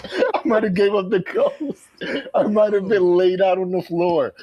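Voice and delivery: high, animated, riding over laughter; laughing exclamation. Real, not acted. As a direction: repeat the joke in delighted exaggeration. Repeats joke louder over laughter.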